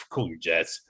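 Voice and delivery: low, dismissive elder; stern elder voice. Acted. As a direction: tell the kid to calm down. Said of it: "The older Kel are like".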